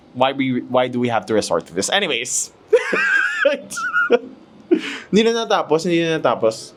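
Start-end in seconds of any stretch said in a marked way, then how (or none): 2.7-4.3 high squealing laugh; laughing fit. Real, not acted. Vlogger cracking up after her own aside, high laughing fit. Breaks up laughing mid-remark.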